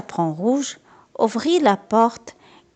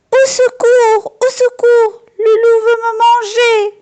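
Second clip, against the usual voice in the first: screaming for help; 13 semitones higher, 6 dB louder than usual. A narrator's reading. Girl fleeing the wolf in the room, screaming for help. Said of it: "en criant".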